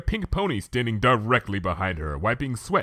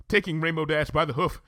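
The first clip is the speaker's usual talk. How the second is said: ordinary delivery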